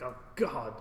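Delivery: normal talking voice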